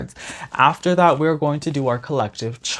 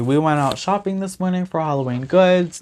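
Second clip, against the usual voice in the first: ordinary talk, nothing marked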